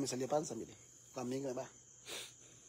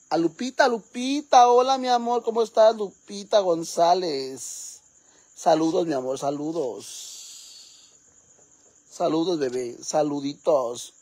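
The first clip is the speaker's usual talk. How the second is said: loud greeting; 10 dB louder, slower than usual. Acted. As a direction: belt out a greeting to a friend. Shout-out greeting to Lupita.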